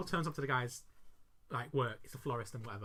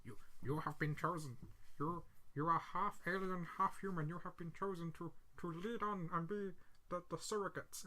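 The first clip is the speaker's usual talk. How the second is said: soft, lightly voiced alien pronouncement; a hint of solemn alien messenger; alien recording; 2 semitones lower, 10 dB quieter than usual. Aliens briefing the chosen girl, lightly voiced solemn announcement. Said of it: "the aliens are like".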